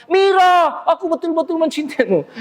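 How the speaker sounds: high, absurdly theatrical love cry; overblown stage voice. Shown as a demonstration. Lover confessing to Mira off stage, absurdly over-projected. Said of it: "tidak wajar kalau di luar panggung mengatakan".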